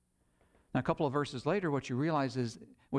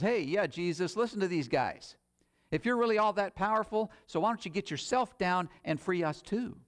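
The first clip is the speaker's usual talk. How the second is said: higher-pitched taunting criminal; mocking; 6 semitones higher than usual. Criminal on the cross to Jesus, acted mocking. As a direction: jeer at him with a raised, goading voice. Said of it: "they weren't the only ones mocking".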